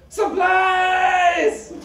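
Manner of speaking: high, loud sung-out shout; bursting out of the bushes, loud and high; yelled punchline. Acted. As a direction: belt it out like a triumphant cry. Korean guy yells punchline.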